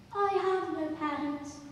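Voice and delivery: put-on high, slow child voice. Child telling the adult of having no parents, childlike.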